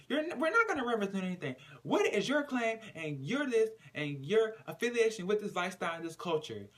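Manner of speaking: fast, high, heated grilling; heated rant. Retold or imitated. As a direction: rattle off the challenge heatedly. Brittany grilling Marissa.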